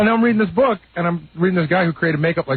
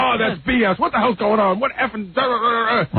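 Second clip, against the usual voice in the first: higher, indignant mock rant; a hint of an enraged old man, higher pitched; mock screaming; 7 semitones higher than usual. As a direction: imitate a furious old comic, lightly. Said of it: "he started screaming at me".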